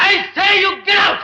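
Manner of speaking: high, drawn-out angry shout; angry order. Acted. Boss orders clerk out. (கோபமாக விரட்டும் கத்தல்)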